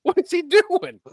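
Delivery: high-pitched, incredulous rant; worked-up, disbelieving complaint; exasperated. Real, not acted. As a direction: protest in baffled disbelief, voice way up. Exasperated complaint about Dom's role.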